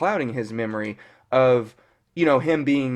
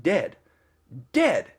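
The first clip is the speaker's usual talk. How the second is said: retold scream; slower than usual. Woman telling Vision he is dead, mock screaming. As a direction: imitate a panicked scream at half volume. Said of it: "screaming it at him".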